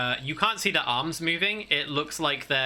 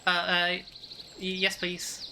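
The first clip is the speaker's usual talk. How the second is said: higher, stammering, polite acceptance; hesitant character voice; 6 semitones higher than usual. Acted. Game master's character accepting help back up, higher and hesitant.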